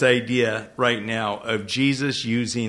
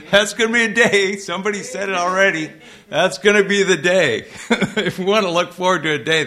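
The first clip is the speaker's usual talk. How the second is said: joyful laughing; 7 semitones higher, 6 dB louder, faster than usual. Real, not acted. Joyful laughter over resurrection day.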